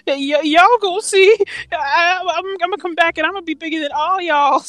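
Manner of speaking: mock Stewie in a Mississippi accent, pitched high, bragging he'll be back. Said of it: "make Stewie sound like he's from Mississippi".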